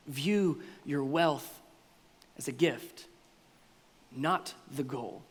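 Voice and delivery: soft and slow, word by word; slow, soft restatement. Shown as a demonstration. Preacher closing the point, saying the key phrase softly and slowly. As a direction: lay it down gently and slowly. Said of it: "slow quiet restatement".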